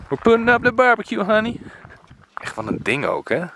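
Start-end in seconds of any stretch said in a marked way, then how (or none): 0.1-1.6 high, loud mock call to honey; put-on English voice. Calling to honey about the barbecue, put-on high voice. Joking English aside in Dutch vlog.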